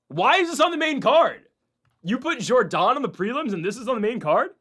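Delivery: loud, high-pitched baffled protest; real incredulity. Real, not acted. Podcaster questioning the main card placement, incredulous. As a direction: question it loudly, voice jumping up in disbelief.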